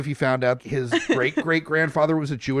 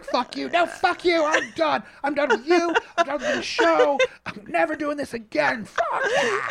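high, hammed-up yelling with laughs; mock fight; 13 semitones higher than usual. Host acts out a blowup ending the show, mock screaming high. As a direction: scream it like a fake meltdown.

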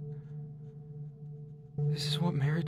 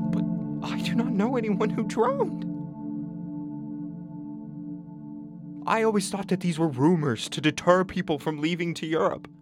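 defensive; 8 semitones higher than usual. Acted. Father tells daughter he doubted stories of drowning, defensive. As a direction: protest it with a raised voice.

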